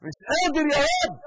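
loud, high, fast cry of مش قادر يا رب; heartfelt cry. Shown as a demonstration. Preacher crying out weakness to God, loud. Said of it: "قولها من قلبك".

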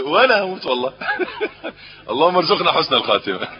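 laughing. Real, not acted. Laughing at the funny exchange.

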